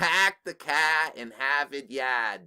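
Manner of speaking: slow, hammed-up Boston "pahk the cah in Hahvahd Yahd"; exaggerated Boston accent, slow; mock Massachusetts accent. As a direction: drop every R like a Boston caricature. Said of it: "a mock Massachusetts accent".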